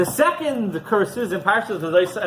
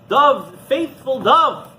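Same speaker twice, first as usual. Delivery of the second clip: slow, drawn-out call, louder; 5 semitones higher, 4 dB louder, much slower than usual. Acted. Rabbi calls up to the flying dove, slow and louder. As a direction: stretch each word out, calling the bird down. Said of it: "calls out".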